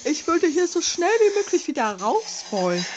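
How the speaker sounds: normal talking voice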